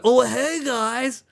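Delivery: chirpy fake American accent; imitating an American accent. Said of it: "That's pretty much how he does that accent".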